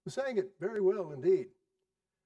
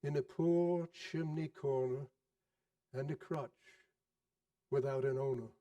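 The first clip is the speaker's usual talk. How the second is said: solemn ghost; 5 dB quieter than usual. Acted. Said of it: "replied the ghost".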